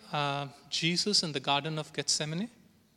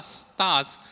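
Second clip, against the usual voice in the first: ordinary talk, nothing marked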